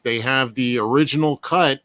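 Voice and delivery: normal talking voice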